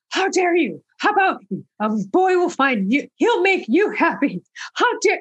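high-pitched mock outrage; scandalized protest, high but not shouted; indignant retort. Retells talking back to the TV.

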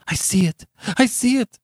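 very high-pitched horrified cry; shriek. A narrator's reading. Beatrice seeing the insects die, shrieking. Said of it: "shrieked Beatrice".

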